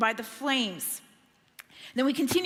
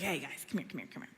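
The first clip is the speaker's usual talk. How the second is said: scheming king; 4 semitones lower, 5 dB quieter than usual. Retold or imitated. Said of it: "So he's like".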